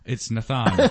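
Nathan said "nuh-THAHN"; mispronounced name. Retold or imitated. Customer copying the clerk's wrong version, Nathan as "nuh-THAHN". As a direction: stress the second syllable of Nathan, with an "ah". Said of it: "I, I actually said".

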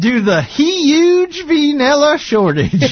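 high, slow southern drawl; exaggerated, drawn-out southern accent. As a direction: stretch it out in a hammed-up Southern voice. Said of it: "talking with a southern accent".

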